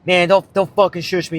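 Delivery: imitating an offended stranger without really yelling; lightly acted irritated comeback; retold anger. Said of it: "he gets mad".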